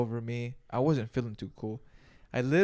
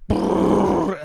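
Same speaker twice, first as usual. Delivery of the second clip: loud buzzing brrr, pitched up; loud retching brrr; 7 semitones higher, 10 dB louder than usual. Retold or imitated. Sick in the restroom, loud brrr. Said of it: "I was just like".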